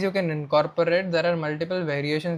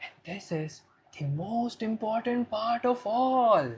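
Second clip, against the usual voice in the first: slow, soft, raised-pitch hush; hushed variation; 4 semitones higher, 6 dB quieter than usual. Shown as a demonstration. Coach shows bringing down the loudness with depth, slow and hushed. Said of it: "maybe you can incorporate this variation".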